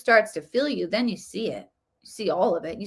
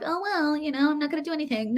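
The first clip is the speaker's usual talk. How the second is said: higher and quick, a lazy shrug of a voice; mock complacent; 6 semitones higher, 4 dB quieter, faster than usual. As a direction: mimic someone shrugging off all effort. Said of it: "That doesn't mean you're complacent".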